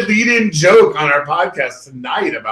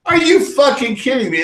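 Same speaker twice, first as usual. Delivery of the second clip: disbelief, pitched much higher; imitating his own outrage, going higher; incredulous; 10 semitones higher than usual. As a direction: repeat it in mock outrage. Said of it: "I was like".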